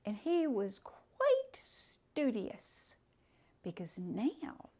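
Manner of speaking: usual speaking voice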